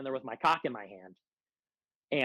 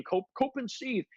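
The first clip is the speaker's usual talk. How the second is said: higher snide sing-song cope, cope and seethe; mocking cope and seethe; 8 semitones higher than usual. Mocking the ex-friend's passive aggressive taunt. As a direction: sneer it like a smug poster. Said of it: "You wouldn't be saying".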